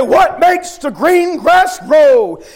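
slow, high bellowed drill call; drill sergeant shout. Retold or imitated. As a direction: bellow it across the parade ground. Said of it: "Whenever he would say".

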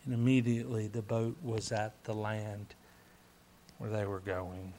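low, soft repeat, fading at the end; hushed awe. Real, not acted. Preacher repeats boat miracle to congregation, hushed and low. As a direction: let the verse sink in, quietly.

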